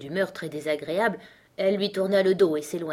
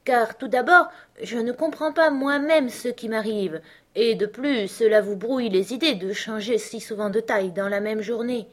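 higher, louder courteous explaining; very polite; 5 semitones higher, 5 dB louder than usual. A narrator's reading. Alice telling the caterpillar of her confusion, very politely. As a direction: explain yourself with great politeness. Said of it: "avec beaucoup de politesse".

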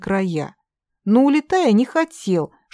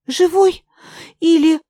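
резко выше, громче, тревожный вопрос; с испугом, почти вскрик; встревоженно; 10 semitones higher, 6 dB louder than usual. Acted. (тревожно и высоко)

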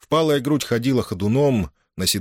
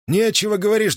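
mocking; 13 semitones higher, 3 dB louder than usual. Sneering throwback of insult.

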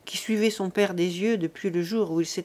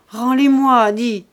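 tearful begging; 3 semitones higher, 9 dB louder, slower than usual. A narrator's reading. Broken old father begging his son for papers, tearful. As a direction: cry out imploringly. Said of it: "Il bégaya en pleurant".

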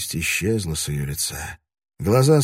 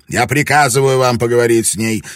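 loud, higher order; stern command; 5 semitones higher, 8 dB louder than usual. A narrator's reading. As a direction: lay down the command. Lord commands wife.

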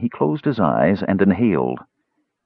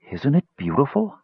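breathed in awe; 7 semitones higher than usual. A narrator's reading. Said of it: "Parian breathed".

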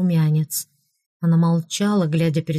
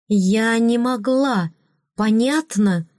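exclaiming; 5 semitones higher, slower than usual. A narrator's reading. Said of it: "воскликнула она".

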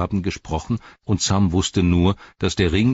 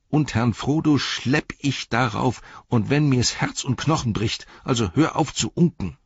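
higher-pitched, insistent defiance; stubborn resolve; 7 semitones higher than usual. A narrator's reading. Sam vowing to carry Frodo up, stubbornly determined. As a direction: push back hard against the doubting voice. Sam defies his doubting inner voice.

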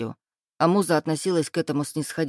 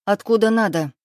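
higher, louder snap back; angry bark; 4 semitones higher, 5 dB louder than usual. A narrator's reading. Wife snaps at jealous husband's question, barking. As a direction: bark it to shut him down. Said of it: "рявкнула Муза".

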